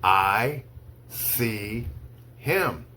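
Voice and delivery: slow, one word at a time with long pauses; slow dictation. Shown as a demonstration. Teacher reads to students for dictation, slow, word by word. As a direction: read it word by word so they can write. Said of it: "I'll say each sentence slowly three times".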